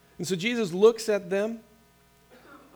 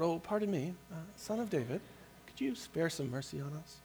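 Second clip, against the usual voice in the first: soft, timid, overly polite plea, much quieter; polite Canadian call out; 10 dB quieter than usual. Retold or imitated. Pastor mocks a timid beggar, a soft overly polite Canadian plea. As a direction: ask for mercy as if apologising for the bother. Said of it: "like a nice, polite Canadian call out".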